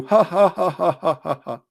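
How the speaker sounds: mock devil cackle, fading out; mocking laugh. Shaitan laughing at the yawner, mock cackle fading. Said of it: "he laugh at you".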